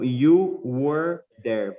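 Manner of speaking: very slow, each word set apart; teacher spelling out the phrase slowly; slow model reading. Shown as a demonstration. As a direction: lay the sentence out slowly for the class. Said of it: "teacher models the English phrase".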